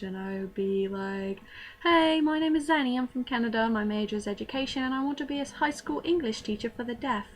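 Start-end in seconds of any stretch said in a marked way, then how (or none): 1.8-7.3 put-on British accent. Student introducing herself to classmates, in a put-on British accent. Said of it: "foreign accent".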